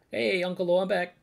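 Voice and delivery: put-on high, loud returning kid; kid calling out. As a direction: call out to uncle as an excited kid. Voices kid returning to village.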